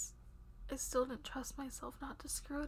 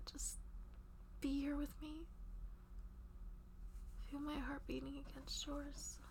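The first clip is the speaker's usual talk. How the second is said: soft, tender bedtime whisper; soothing whisper; 5 dB quieter than usual. Acted. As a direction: lull them gently to rest. Comforting partner to rest.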